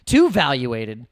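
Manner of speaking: high, loud mock protest on too; hamming up a gatekeeper refusing. Gatekeepers not wanting their coin pumped, mock whine. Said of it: "Maybe they're like".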